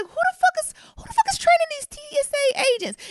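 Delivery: outraged squeal at the TSA; indignant rant. Real, not acted. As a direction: demand an answer, fuming. Ranting about TSA training.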